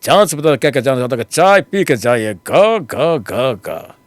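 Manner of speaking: loud, fast filmi villain dialogue; Shatrughan Sinha voice. Retold or imitated. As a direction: boom it out like a Bollywood villain. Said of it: "Shatrughan Sinha".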